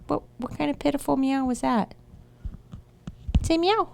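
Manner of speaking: high, sing-song voice for coaxing a cat; cooing pet voice. Real, not acted. Coaxing her cat to meow, high cooing pet talk.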